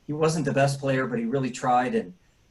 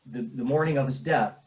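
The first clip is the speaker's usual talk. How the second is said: ordinary talk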